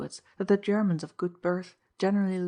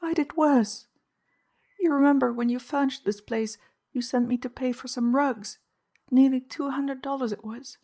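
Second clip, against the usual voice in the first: higher, louder confessing woman; anxious housekeeper; 7 semitones higher, 4 dB louder than usual. A narrator's reading. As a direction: blurt out the guilt, higher. Mrs Kinney confesses.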